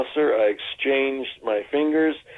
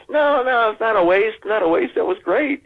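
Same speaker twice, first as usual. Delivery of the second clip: reassuring; 11 semitones higher than usual. Retold or imitated. Director quickly reassuring the actor, much higher. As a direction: rush to comfort him. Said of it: "And he goes".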